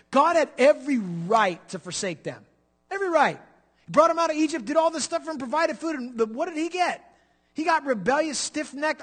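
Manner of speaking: high, loud pulpit fervour; impassioned preaching. Real, not acted. Preaching at full force.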